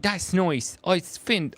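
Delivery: high-pitched mock Northern Irish accent; bad Northern Irish accent. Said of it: "I can't do the accent".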